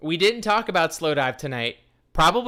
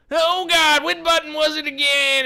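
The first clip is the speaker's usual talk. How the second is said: high, loud mock panic; 13 semitones higher, 6 dB louder than usual. Host panicking over which button to hit, loud high mock panic. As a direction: squeal in playful alarm.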